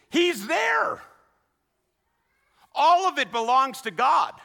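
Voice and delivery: loud, high, insistent, hammering each line; preaching at full force. Acted. Pastor hammering home that God is everywhere, loud and high. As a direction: drive home that God is there.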